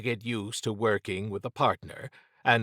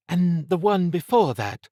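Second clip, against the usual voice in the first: woman's voice; 8 semitones higher, 5 dB louder than usual. A narrator's reading. Trish's quoted reply.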